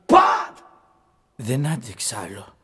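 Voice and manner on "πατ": loud exploding slap sound; imitated slap noise. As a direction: fire off a slap effect at full voice. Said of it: "Χαστούκ". (δυνατός ήχος χαστουκιού)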